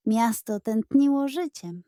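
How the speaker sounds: usual speaking voice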